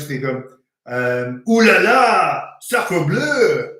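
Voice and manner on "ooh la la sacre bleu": loud, exaggerated mock-French exclamation. English person asked to say something French, hammed-up French accent. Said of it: "asking an English person to say something French".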